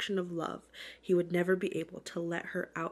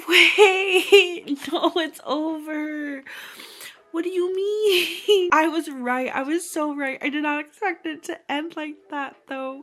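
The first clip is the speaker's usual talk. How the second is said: high, loud, drawn-out disbelief with laughs; shocked outcry; 8 semitones higher, 10 dB louder, slower than usual. Real, not acted. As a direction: cry out in laughing protest at the ending. Shocked that the book has ended.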